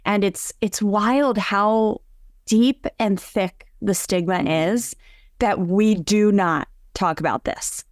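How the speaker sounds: usual speaking voice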